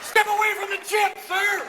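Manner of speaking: high, loud bodyguard's order; urgent command. Acted. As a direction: shout a mock emergency warning. Secret Service ordering the President.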